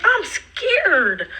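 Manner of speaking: mock scared wail. Mock fear while fleeing friend.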